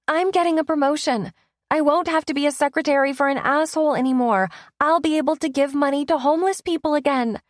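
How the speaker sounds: gushing with joy, words tumbling out; over-the-top excited. Acted. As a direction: ecstatic girlfriend celebrating her promotion. Over-the-top excited about promotion.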